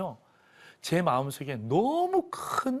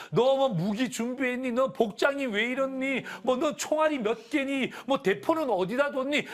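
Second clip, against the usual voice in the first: high-pitched busy nagging questions; noisy chatter; 7 semitones higher than usual. Acted. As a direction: imitate soldiers chattering among themselves, high-pitched. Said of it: "막 우리끼리 왁자지껄하면". (왁자지껄 떠드는 높은 목소리 흉내)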